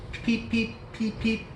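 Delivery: high, soft peep peep; chick peeping. Acted. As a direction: squeak it like a tiny chick. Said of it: "That's what the chicks say".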